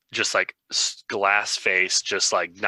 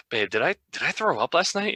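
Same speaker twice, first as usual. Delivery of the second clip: sheepish question; 8 semitones higher than usual. Retold or imitated.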